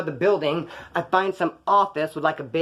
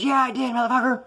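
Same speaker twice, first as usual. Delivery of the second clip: high-pitched defiant comeback; taunting confession; 7 semitones higher than usual. Retold or imitated. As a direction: throw it out high, like a parting taunt. Wife's taunting confession as she drives off.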